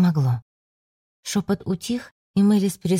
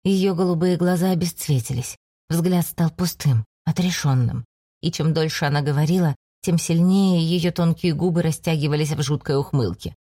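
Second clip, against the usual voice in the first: usual speaking voice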